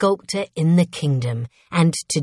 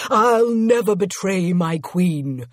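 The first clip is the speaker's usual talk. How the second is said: slow, projected vow; staunch, drawn-out defiance; defiant loyalty; 3 dB louder, slower than usual. A narrator's reading. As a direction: declare loyalty in the face of a threat. Servant defiantly refuses the rebel.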